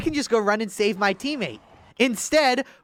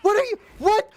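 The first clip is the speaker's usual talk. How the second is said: higher, louder disbelieving shouts; exasperated yelling; 11 semitones higher, 3 dB louder than usual. Real, not acted. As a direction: yell at the screen in disbelief. Exasperated at a bad player.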